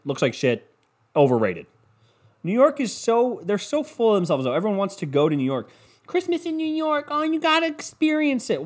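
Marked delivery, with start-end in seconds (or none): 6.1-8.6 high, gushing mock voice; mocking gushing fan. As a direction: parody an excited tourist. Mocking New York fans.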